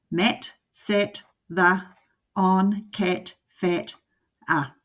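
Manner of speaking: ordinary delivery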